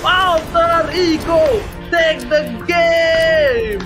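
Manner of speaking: very slow drawn-out victory shout; fighting game announcer. Real, not acted. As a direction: announce the winner, stretch every word. Caster calls the game win. (seruan kemenangan penyiar)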